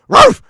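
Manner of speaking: sudden high loud ruff; loud dog bark. Retold or imitated. [name] impression ending with a loud dog bark.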